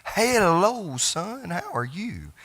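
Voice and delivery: high, bright sing-song hello from mom; mother's cheery greeting. Retold or imitated. Son imitating his mother calling him on the phone, high sing-song. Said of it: "She starts every conversation with".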